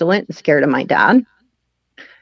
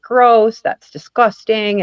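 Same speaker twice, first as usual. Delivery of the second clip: disgusted; 5 semitones higher, slower than usual. Retold or imitated. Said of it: "he's like".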